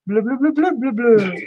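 high, gurgly blue-blue fish babble; bubble speech. Pretend. Crafter doing the fish's bubble language, high babble. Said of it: "That's bubble talk".

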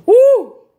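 high, loud "woo"; loud whoop. Real, not acted. Speaker feeling the heat, whooping loudly. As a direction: let out one big high whoop. Hot, whooping between remarks.

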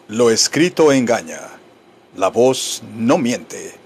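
low, slow, measured maxim; solemn motto. Shown as a demonstration. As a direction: deliver it like a proverb, pausing.

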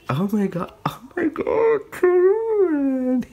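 climbs into a high excited squeal; rising squeal. Real, not acted. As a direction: build from gasp to squeal. Gushing over the ten card.

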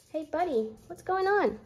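high sing-song voice for the dog; pet talk. Real, not acted. Talking sweetly to her barking dog Shiloh, high pet voice.

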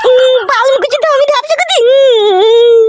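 high put-on woman's voice, whimpering; woman's voice, hurt. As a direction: sulk in falsetto like an offended wife. Plays wife, hurt. (উঁচু মেয়েলি গলায় অভিমানী কান্না)

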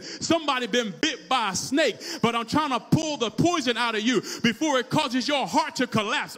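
urgent sermon, climbing to a high peak; full-force preaching. Real, not acted. Preacher promises to pull out the poison, full-force preaching. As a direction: drive the congregation toward a shouted climax.

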